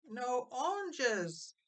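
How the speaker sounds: put-on high, slow toddler voice; a baby pointing out the empty mantel; little Iva. A narrator's reading. Toddler Iva to her family, high and slow. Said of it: "little Iva gurgled".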